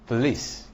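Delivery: "police" with a weak first syllable; stress on second syllable. Shown as a demonstration. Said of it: "does not take stress".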